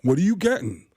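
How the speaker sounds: a hint of Tracy Morgan ordering; lightly voiced comic order; Tracy Morgan impression. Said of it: "one of my impressions".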